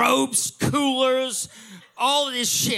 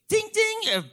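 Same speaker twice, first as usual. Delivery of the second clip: high-pitched "ding, ding"; imitated airport chime; 11 semitones higher than usual. Airport PA interrupts the crowd, mimicked high chime. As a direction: sing out a mock PA chime.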